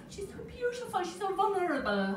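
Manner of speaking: high, overcome Irish mother; mum's Irish accent. Acted. Irish mum cooing over newborn, high Irish lilt. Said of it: "I don't know why Irish people have".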